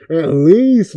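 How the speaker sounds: normal talking voice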